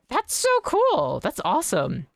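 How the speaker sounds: high, slow gushing delight; high, drawn-out squeal of delight. Real, not acted. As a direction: gush over it. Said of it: "said twice".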